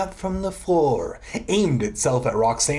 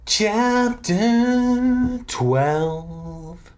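very slow, high, sustained tones; slow mock-grand chapter title; drawn-out chapter announcement; 9 semitones higher, much slower than usual. A narrator's reading. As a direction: intone the chapter heading, stretching each word.

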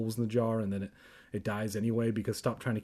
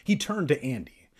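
ordinary delivery

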